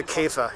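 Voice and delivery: "Käfer" said kay-fuh; German "Käfer", soft final r; German word. Shown as a demonstration. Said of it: "is what they call it".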